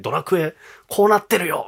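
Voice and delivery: retold childish excitement. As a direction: show dad the new game, thrilled. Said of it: "それこそ興奮して話した記憶ありますもん". (父親に興奮して話す子供の声で)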